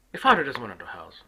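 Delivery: normal talking voice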